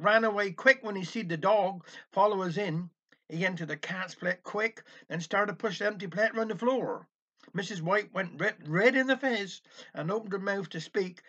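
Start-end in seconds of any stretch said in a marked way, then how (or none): none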